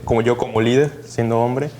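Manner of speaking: normal talking voice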